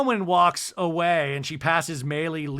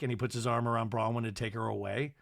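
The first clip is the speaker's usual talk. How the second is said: ordinary talk, nothing marked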